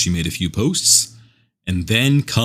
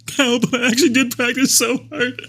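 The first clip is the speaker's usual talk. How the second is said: much higher, laughing mock [name] protest; imitating [name]. Co-host imitates [name]'s laughing protest, much higher. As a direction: squeak it out like a hurt pop star, laughing. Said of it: "she's like".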